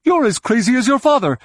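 fast, high-pitched yell; quick, high outburst in battle; shouting. A narrator's reading. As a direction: shout exasperatedly at a comrade. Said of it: "Droma shouted".

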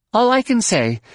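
high-pitched, impatient pilot; tense young woman pilot. Acted. Pilot asks her passenger to watch for obstacles, testily. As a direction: pitch it well up, urgent and testy.

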